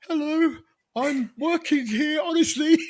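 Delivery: timid falsetto pleading; nervous high voice. Retold or imitated. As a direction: sound like a scared kid talking to a soldier. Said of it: "My voice was that high".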